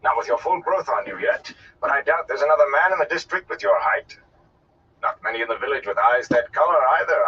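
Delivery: a touch of showman's flourish; appraising gleeman. Acted. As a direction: size the boy up aloud. Gleeman sizes up a lad.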